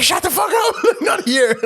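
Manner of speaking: very high, loud yell, breaking into laughter; shouting. Retold or imitated. Son acting out dad yelling at border guards, shouting. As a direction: yell it full out as the exploding dad, then crack up. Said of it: "he starts shouting".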